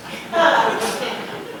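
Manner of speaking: high airy laugh; short high breathy laugh. Real, not acted. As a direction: laugh at his own joke.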